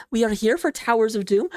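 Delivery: ordinary talk, nothing marked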